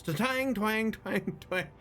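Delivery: high twanged strums by mouth; the drunk's harp, imitated vocally; harp twangs. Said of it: "And he's like".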